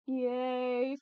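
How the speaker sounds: ordinary delivery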